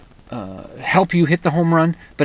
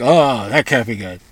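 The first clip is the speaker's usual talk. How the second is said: lightly voiced mock skeptic, a touch lower; a hint of a disapproving old-timer; doubtful scoff; 5 semitones lower than usual. Mocking horse and buggy people.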